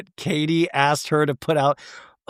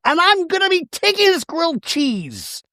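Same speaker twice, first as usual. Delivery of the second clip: much higher, haughty mock exit line; Shannon impression; 13 semitones higher than usual. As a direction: announce it like a grand housewife.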